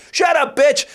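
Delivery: sharp mock shout across a club; heckler's yell. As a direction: holler it down from the balcony. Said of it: "a guy from up top goes".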